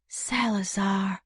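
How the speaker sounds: Salazar whispered slowly, syllable by syllable; careful whisper. Shown as a demonstration. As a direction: sound out Salazar under your breath. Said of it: "I had to sound it out carefully".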